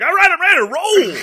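loud, high, hyped-up wrestler; over-the-top pumped shout; amped-up. Acted. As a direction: burst out raring to go. Imagined pill-crazed wrestler amped up.

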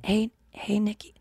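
hushed attempt at the greeting; soft ASMR try. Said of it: "Wait, I wanna, like, even try to be".

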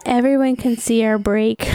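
ordinary delivery

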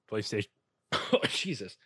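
choking on beer mid-word; while sipping a drink. Real, not acted. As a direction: cough through a sip of beer. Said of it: "I'm drinking a beer and it's not going well talking".

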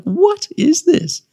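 high, drawn-out disbelief; taken aback. Retold or imitated. Recalling his reaction to party websites, taken aback. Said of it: "I was just taken aback".

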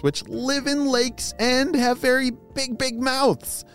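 high, bright praise for little listeners; kindergarten teacher voice. Acted. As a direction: cheer the kids on sweetly. Cheery quiz to children.

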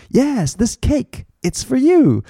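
very high, slow example voice; hammed-up offering of cake, high; acted gift giver. Shown as a demonstration. Teacher plays someone giving cake, high drawn-out acted reply.

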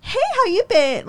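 very high, fake-cheery small talk; high-pitched pretend niceness; mock friendly greeting. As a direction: put on a sweet, chirpy hello. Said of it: "I'm not gonna be like".

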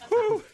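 high breathy whew of exhaustion; exaggerated winded exhale. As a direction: blow out like you just ran a mile. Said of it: "I'm winded".

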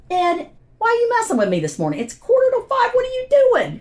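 high, disbelieving protest at a joke; incredulous. Retold or imitated. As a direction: pitch it way up like you can't believe the call. Disbelief at a dawn phone call.

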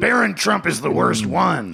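usual speaking voice

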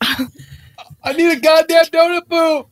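demanding shout. Real, not acted. Actor demanding his donut puffs.

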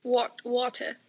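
put-on halting try at an English accent; fumbled imitation of English "water"; attempted English accent. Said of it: "I'm so bad at an English".

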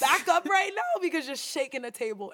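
loud, high, hammed-up sassy comeback; sassy mock talk-back. Joking about telling the therapist off, loud sassy mock voice. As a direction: fire back with mock attitude, laughing into it. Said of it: "I was like".

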